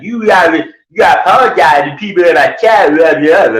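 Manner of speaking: high-pitched mock yelling; hammed-up high rant; Glenn. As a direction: imitate a garbled, agitated telling-off. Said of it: "like, yelling at me".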